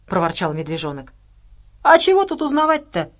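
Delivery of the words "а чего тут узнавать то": loud, high contemptuous retort; a scornful spat-out question. A narrator's reading. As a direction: spit the words with scorn. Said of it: "сплюнул Дима".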